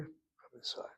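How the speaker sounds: very soft, near-whispered invitation; hushed welcome. Acted. Host invites a hungry stranger inside, hushed and welcoming. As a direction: gently usher someone in under your breath.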